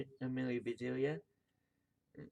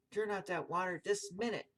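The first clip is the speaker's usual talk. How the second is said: higher-pitched scolding command; storybook scolding; mild shouted order; 7 semitones higher than usual. A narrator's reading. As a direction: order them firmly, lightly raised. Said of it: "shouted Missus Rogers".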